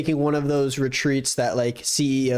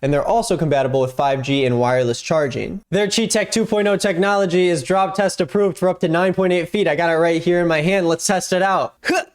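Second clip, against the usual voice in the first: upbeat sponsor read; 5 semitones higher than usual. Acted. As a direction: sell it, bright and eager.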